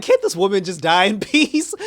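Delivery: high, loud, laughing exasperation; exasperated. Acted. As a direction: complain loudly through a laugh. Mock exasperation at drawn-out plot.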